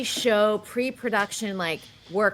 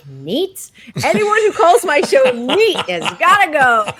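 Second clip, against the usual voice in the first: [name], mock outraged, much higher and louder; indignant [name]; 6 semitones higher, 8 dB louder than usual. Retelling [name]'s reaction to Kevin Costner, mock outraged. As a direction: scoff at the word neat, then fire him in a huff. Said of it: "And she's like".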